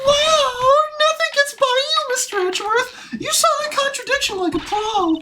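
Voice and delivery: very high, loud excitable cartoon voice; gushing witness character. Witness praising Mister Edgeworth, high excited gushing. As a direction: gush in a squeaky character voice.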